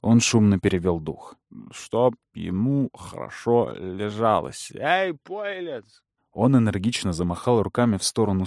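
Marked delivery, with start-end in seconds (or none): 1.7-4.7 put-on slow slurred drunk mumble; drunk slurring. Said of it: "заплетающимся языком". 4.8-6.0 put-on drunk call. Drunk calls to the barman.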